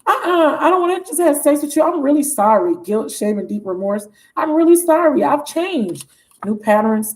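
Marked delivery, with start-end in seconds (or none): 0.1-2.7 higher, mock-earnest protest; put-on apologetic lover; pleading man. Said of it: "And he's like". 4.4-6.1 higher put-on apology; mock-earnest lover's plea; pleading man. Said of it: "And he's like".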